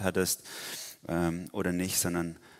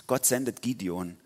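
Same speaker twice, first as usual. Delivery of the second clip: ordinary talk, nothing marked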